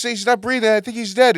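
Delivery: high, loud, urgent report; hammed-up alarmed caller; mock panic. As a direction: blurt it out in comic alarm. Panicked caller in a told joke.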